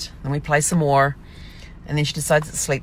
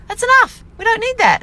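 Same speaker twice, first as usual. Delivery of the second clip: much higher and louder, scolding the puppy; stern scolding; 7 dB louder than usual. Retold or imitated. Owner with head out of shower scolding puppy, high loud. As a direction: cut the howling off firmly.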